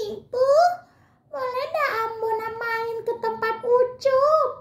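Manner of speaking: put-on child asking mother to go play, high voice; small child voice. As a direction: ask mother sweetly as a little child. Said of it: "itu suara kecil".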